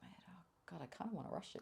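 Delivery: fast, urgent whisper; a quick desperate whisper to herself; whispered inner plea. Retold or imitated. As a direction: rush it out under the breath. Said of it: "I was like".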